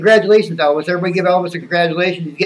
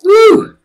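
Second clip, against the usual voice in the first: loud, high "woo"; relieved whoop; 13 semitones higher, 9 dB louder than usual. Real, not acted. Host cooling off after playing Skip, loud whoop. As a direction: let out a sharp, high whoop.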